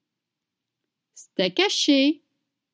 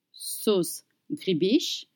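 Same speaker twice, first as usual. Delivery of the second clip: ordinary talk, nothing marked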